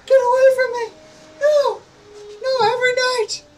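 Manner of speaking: high, loud panicked shrieking; terrified screaming. Acted. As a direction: scream at the vampire at the window. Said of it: "She says".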